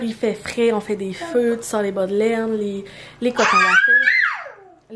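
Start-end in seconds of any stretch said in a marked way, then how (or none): 3.2-4.9 high, laughing shout of delight; laughing squeal. Real, not acted. Vlogger gushing to viewers about cozy fall clothes, laughing squeal.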